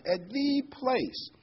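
"at the place" very slow and high, "place" lifted; stress on "the place". Shown as a demonstration. Pastor circled the word, emphatic high "the place". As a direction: pitch up and draw out "at the place". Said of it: "so I would emphasize".

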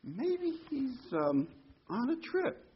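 high, teasing mock advice; sarcastic mockery. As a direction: needle them like a smirking heckler. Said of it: "in a very sarcastic, humorous way".

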